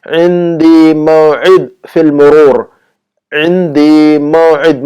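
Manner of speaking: very slow عندی موعد, each ain squeezed and held; exaggerated ain. Shown as a demonstration. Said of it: "عین نکالیے".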